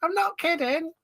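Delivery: very high, squeaky put-on voice; silly high-pitched impression; Leatherface. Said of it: "And that's his voice".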